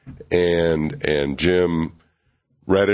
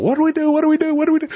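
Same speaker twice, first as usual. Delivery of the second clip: very high, louder panicky squeal; repeated frantic high-pitched pleading; mock panic; 4 dB louder, much faster than usual. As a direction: squeak it out like a flustered young writer chasing the boss. Running after Roy, panicking.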